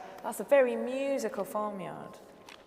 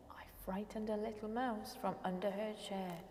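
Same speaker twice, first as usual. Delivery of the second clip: very soft whispered answer; 7 dB quieter than usual. Acted. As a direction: whisper it like a secret. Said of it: "whispered rhyme answer".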